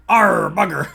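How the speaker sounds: hammed-up pirate grumble; pirate curse. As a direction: growl it like a vexed sea dog. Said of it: "I was too piratey".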